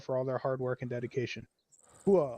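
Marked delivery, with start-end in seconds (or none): 2.0-2.4 loud gung-ho soldier. Real, not acted. Unit award ceremony call-out.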